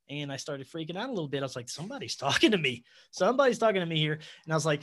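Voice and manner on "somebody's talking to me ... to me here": fast, louder, half laughing; excited disbelief. Retold or imitated. Man hearing a voice in meditation, fast nervous laughing disbelief. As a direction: blurt it out in nervous amazement.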